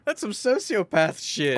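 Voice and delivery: high, slowed, half laughing; amused disbelief. Real, not acted. Cohost ribbing guest about fake crying, amused slow disbelief. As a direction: tease him with mock horror.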